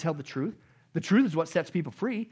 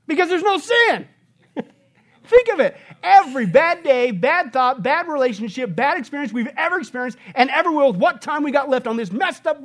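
loud, soaring, high-pitched exclamation; excited preaching; 7 semitones higher, 9 dB louder than usual. Real, not acted. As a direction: proclaim it with full joy at the top of your voice. Preacher exults over sinless world.

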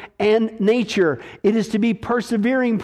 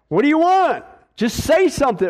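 impatient officer; 6 semitones higher than usual. Retold or imitated. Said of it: "the officer would say".

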